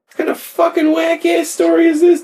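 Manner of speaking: high, outraged disbelief; incredulous. Real, not acted. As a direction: shriek it in disbelief. Outraged aside at the story.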